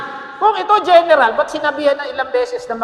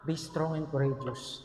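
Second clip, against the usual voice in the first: much softer, slow, gentle encouragement; soft reassurance; 7 semitones lower, 12 dB quieter, slower than usual. Retold or imitated. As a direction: say it slowly and softly to a frightened man. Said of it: "wala na si Joshua".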